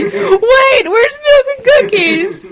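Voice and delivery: high, loud dismayed question; hammed-up cartoon alarm; alarmed child's cry. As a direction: call out high like a baffled kid. Acting the kids' alarmed question.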